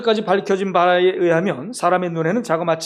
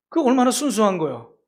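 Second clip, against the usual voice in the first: ordinary talk, nothing marked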